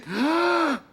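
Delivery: sharp high intake of breath; sudden high gasp. Acted. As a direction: gasp high before the shout. Family bursts out at candles. (inspiration brusque et aiguë)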